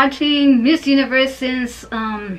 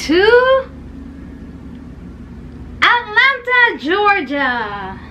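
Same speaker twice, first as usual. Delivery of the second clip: excited announcement; 8 semitones higher, slower than usual. Real, not acted. Vlogger reveals her trip to Atlanta, excited announcement. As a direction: announce it high and proud, then drop.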